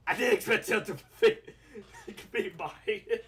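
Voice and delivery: can't keep it together, laugh as you say it. Real, not acted. Telling Joe he didn't expect him to buy it, laughing.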